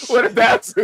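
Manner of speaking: ordinary talk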